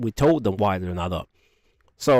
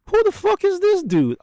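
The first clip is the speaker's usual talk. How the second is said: much higher, louder incredulous mock yell; retold outburst; 4 dB louder than usual. Corporal reacting to the general, high loud disbelief.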